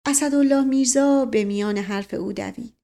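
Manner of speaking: ordinary delivery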